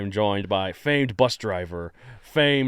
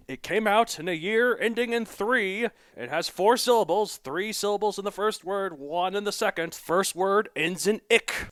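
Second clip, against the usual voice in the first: higher, building hype like a quiz host; teasing build-up; 9 semitones higher than usual. Acted. Host teasing listeners about his favorite movie, hyped and higher.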